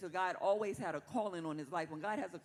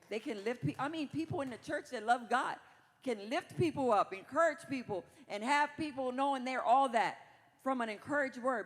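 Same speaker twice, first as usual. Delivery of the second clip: higher, louder preacher's lift; raised preaching; 5 semitones higher, 5 dB louder than usual. Acted. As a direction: lift the room, praising the church.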